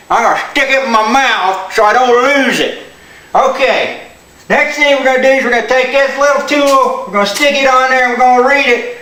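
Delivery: ordinary delivery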